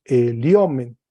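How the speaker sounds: "eliomen" leaning firmly on the O; stress moved later. Shown as a demonstration. Teacher confirms first plural, stressed O in "eliomen". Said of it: "El acento agudo caminó".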